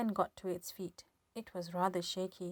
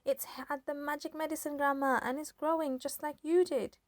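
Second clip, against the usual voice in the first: excited shout; 7 semitones higher, 4 dB louder than usual. A narrator's reading.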